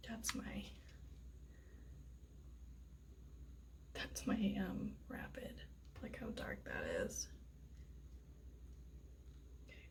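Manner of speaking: hushed whisper over the result. Real, not acted. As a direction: whisper so nobody hears.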